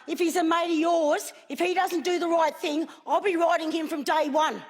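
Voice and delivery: usual speaking voice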